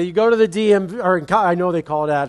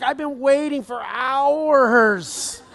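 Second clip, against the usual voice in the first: slow, drawn-out whine, higher, laughing through it; whiny kid; 6 semitones higher, much slower than usual. Retold or imitated. Dad imitating his son Boo Bear whining, slow and laughing. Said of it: "He's like".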